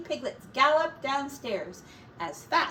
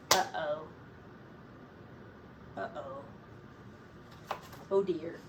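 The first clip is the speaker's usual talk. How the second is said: low, fading to a hush; ominous uh-oh; 6 semitones lower, 9 dB quieter than usual. A narrator's reading. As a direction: let the dread sink in. Narrator builds suspense.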